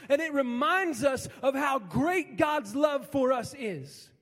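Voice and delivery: loud, slow, emphatic preacher; impassioned pulpit proclamation; preaching at full force. Acted. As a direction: hammer each line slowly. Preaching climax.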